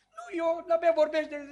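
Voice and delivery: put-on high, quick little-kid denial; child denying. Child blaming his sibling, in a high mock voice. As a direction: chirp it like a small child caught out. Child denies breaking the toy.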